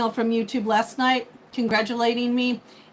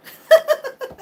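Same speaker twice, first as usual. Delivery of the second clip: loud high laugh; 8 dB louder than usual. Real, not acted. Laughing at her own comeback.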